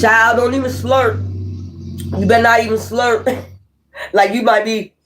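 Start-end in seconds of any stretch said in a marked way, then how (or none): 0.0-3.5 mock stern parent, pitched higher; strict parent scolding. Reader imitating a strict parent warning a child at the table, mock stern. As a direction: scold the child for slurping, half-joking.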